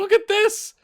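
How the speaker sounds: sudden high, loud exclamation; surprised outburst. Real, not acted. Streamer spotting a player frustrated by encouragement, surprised outburst. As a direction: burst out in disbelief. Reacting to a team talk event.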